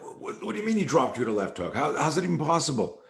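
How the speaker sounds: imitated astonishment, pitch climbing; retold disbelief. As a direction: stammer into an incredulous question, acting it out. Retold baffled reaction to the news.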